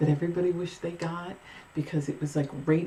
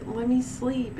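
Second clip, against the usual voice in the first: higher-pitched mock sleepy plea; pleading protest; 7 semitones higher, slower than usual. Sleeper to her hungry cat, higher pleading protest. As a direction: plead to be left in bed.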